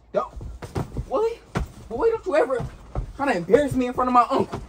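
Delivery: furious scold in front of the uncle; angry scolding. Real, not acted. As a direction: chew him out for embarrassing you.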